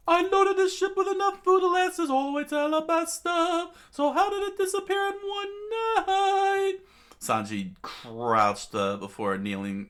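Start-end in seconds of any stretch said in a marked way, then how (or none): 0.1-6.8 very high, loud falsetto yell; furious shouting. Acted. Sanji confronts his evasive captain, furious shouting. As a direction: screech the demand, stretching the final words. Sanji rages over missing food.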